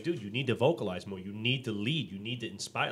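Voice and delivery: usual speaking voice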